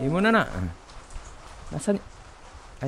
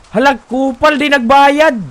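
furious yell after a runaway; angry shout; 11 semitones higher, 15 dB louder than usual. Pretend. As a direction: shout it at the one who ran. Customer leaves without paying.

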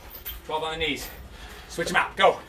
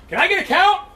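calling out; 8 semitones higher, 3 dB louder than usual. Real, not acted. Coach calls for a count.

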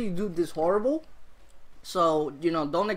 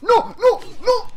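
high, loud panicked no; panicked shout; 12 dB louder, slower than usual. Real, not acted. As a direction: yell in sudden alarm. Getting hit in game.

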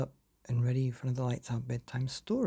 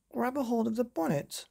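high, urgent father's order; 9 semitones higher than usual. Acted. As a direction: tell the girl to grab on. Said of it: "he ordered Myrtle".

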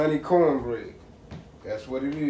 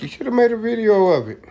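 quoting her; 7 semitones higher, 8 dB louder, faster than usual. Retold or imitated. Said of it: "She was like".

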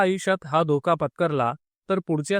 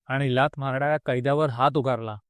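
ordinary delivery

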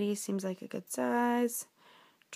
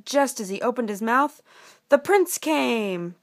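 slow, building storyteller; suspenseful storybook narration; 5 dB louder, slower than usual. Acted. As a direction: build suspense for children, stretching key words.

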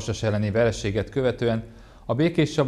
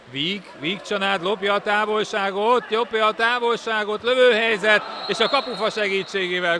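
rising excitement toward a shot; excited hungarian sports commentary; 6 semitones higher, 4 dB louder than usual. Real, not acted. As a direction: build up as the attack develops. Live water polo play-by-play. (izgatott sportkommentár)

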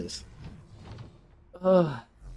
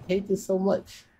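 ordinary delivery

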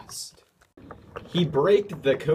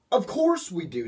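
snapped; 6 semitones higher than usual. A narrator's reading. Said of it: "snapped Duck".

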